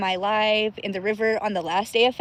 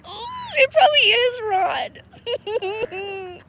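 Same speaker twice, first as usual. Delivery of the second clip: dismayed agreement; 10 semitones higher, much slower than usual. Real, not acted. Agrees with a viewer about the debris.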